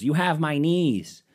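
high, drawn-out mom calling over a fence; stretched-out, high mock mom call; mom's call. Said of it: "she goes".

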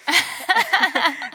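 a quick high burst of laughter; short laugh. Real, not acted. Laughing at his old job title. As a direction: laugh at being construction worker [name].

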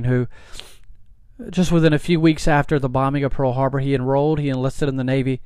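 ordinary talk, nothing marked

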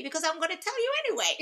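quick, high, laughing tease. Real, not acted. Teacher teasing her class that the story comes anyway, laughing. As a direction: laugh it out as a playful joke.

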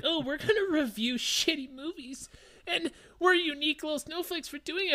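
mocking famous people. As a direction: sneer like a smug celebrity. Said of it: "famous people can be like".

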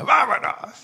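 Lupita's urging. Retold or imitated. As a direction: pitch it up high, like Lupita hurrying someone off. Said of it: "dice Lupita".

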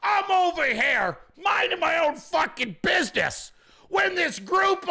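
high panicked excuse; panicked rambling excuse. Retold or imitated. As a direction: babble your way out in a high panic. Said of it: "You're like".

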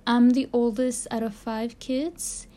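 ordinary talk

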